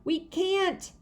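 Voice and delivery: high gleeful yell. A narrator's reading. Fudge answering Sheila, high playful yell. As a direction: call it out while spinning. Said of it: "Fudge yelled".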